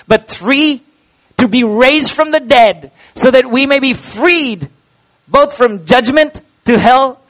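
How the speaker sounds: a pastor rising to the height of his sermon; full-force preaching. Real, not acted. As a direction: preach it out to the whole room. Preaching the three points.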